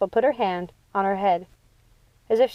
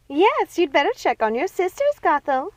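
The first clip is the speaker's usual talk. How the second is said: higher, eager outcry; egging her on; crying out; 9 semitones higher than usual. Acted. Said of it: "cried Ruby".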